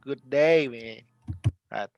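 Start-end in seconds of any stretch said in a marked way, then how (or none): none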